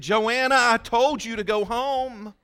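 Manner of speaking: scolding call. Retold or imitated. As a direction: sharply order her home from across the room.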